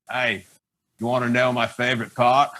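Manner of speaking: low, teasing Australian voice; Aussie accent. Retold or imitated. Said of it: "He's doing Aussie accent impersonations".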